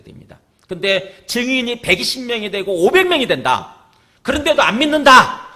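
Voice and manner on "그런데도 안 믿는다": shouted high and loud; loud preaching outburst; forceful cry. Real, not acted. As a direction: hammer the point home to the congregation. Preacher stresses disbelief.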